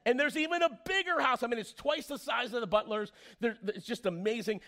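preacher getting carried away describing a huge house; excited storytelling. A narrator's reading. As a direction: sell the mansion like it's the best news ever.

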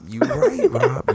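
high laugh through the words. Real, not acted. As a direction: concede it while cracking up. Laughing agreement with Rob.

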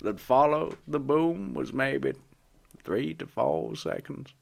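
put-on bass voice of a hardened smoker; chain-smoker bass voice. [name] describing the boom, comic chain-smoker voice. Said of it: "is she the bass section of a fucking barbershop quartet".

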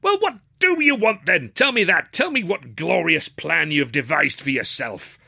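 high, exasperated demand, slowing at the end; indignant demand turning to slow sarcasm; frustrated father. A narrator's reading. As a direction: shake an answer out of a son. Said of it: "in his frustration he began to shake me".

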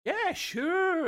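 very high, drawn-out mock assent; mock agreeing. Smuggler explaining away the Romulan ale, high mock agreement. Acts character bluffing about the ale.

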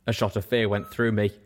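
ordinary delivery, nothing marked

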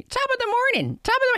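very high, loud mock-Irish greeting; mimicking Shannon. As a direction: parody a bad stage-Irish catchphrase. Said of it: "never wanna hear Shannon say".

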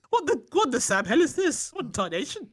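high-pitched mock-outraged impression; teasing impression. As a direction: pitch it up in exaggerated, old-fashioned bafflement. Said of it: "You are like".

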